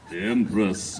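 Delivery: low, solemn intonation; intoning. Acted. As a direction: intone the title gravely. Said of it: "I intoned".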